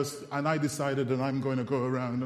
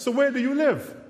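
pitched well up; retold question; 10 semitones higher, 4 dB louder, faster than usual. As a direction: act out the question in a high voice. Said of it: "so I said".

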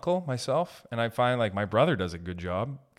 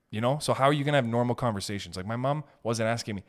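normal talking voice

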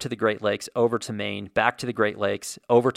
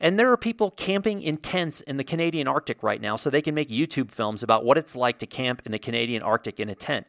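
normal talking voice

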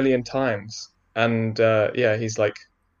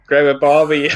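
mock Australian accent; 4 semitones higher, 6 dB louder than usual. Asked for American, jokes back in hammed-up Australian. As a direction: joke Aussie voice, higher and louder. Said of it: "Give me an American accent".

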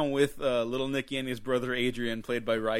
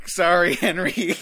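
laughing apology; 4 semitones higher, 8 dB louder, slower than usual. Retold or imitated. Quoting apology while laughing.

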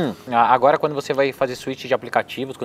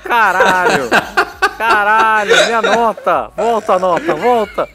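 high, loud laughing panic; panicked laughing; 10 semitones higher, 5 dB louder, slower than usual. Real, not acted. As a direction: laugh in real dismay. Loses his text, panics laughing.

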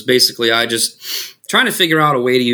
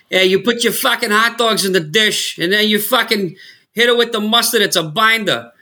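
hammed-up Boston accent, pitched higher; stupid Boston accent; 7 semitones higher than usual. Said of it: "this stupid fucking Boston accent".

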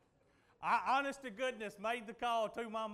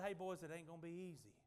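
quiet warning; 6 semitones lower, 9 dB quieter, faster than usual. Retold or imitated. Jesus warning the disciples softly.

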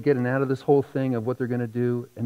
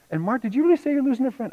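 much higher, louder mock mother's scolding; hammed-up mom laying into them; 10 semitones higher, 5 dB louder, faster than usual. Mother scolds son and dad for leaving the little one, high mock scolding.

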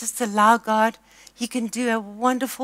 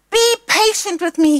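high, loud pleading servant; begging; 8 semitones higher, 6 dB louder than usual. A narrator's reading. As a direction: plead for mercy, voice lifted high. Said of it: "he begged".

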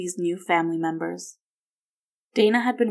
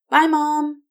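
put-on high, loud child's goodbye; calling out; 9 semitones higher, 7 dB louder than usual. A narrator's reading. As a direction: call back from the front door. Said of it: "Will called from the front door".

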